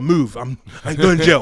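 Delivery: loud rough shout of a murderer; a loud, pushy bark to move; murderer barging past. Retold or imitated. Murderer shoving past in court.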